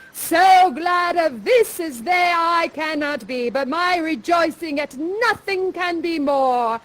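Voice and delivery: slow, grand stage aside; Prospero's aside. Acted. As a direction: savour each word like an old magician. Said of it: "Prospero says".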